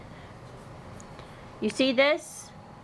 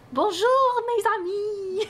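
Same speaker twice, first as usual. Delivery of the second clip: mock French greeting; 11 semitones higher, much slower than usual. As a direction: ham up a French hello.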